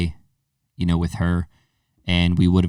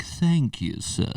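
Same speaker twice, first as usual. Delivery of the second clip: higher, softer mock niece; imitating his niece; 5 semitones higher, 7 dB quieter, much slower than usual. Uncle quoting niece opening the door, imitating her. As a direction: lightly put on a little niece's politeness. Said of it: "she's like".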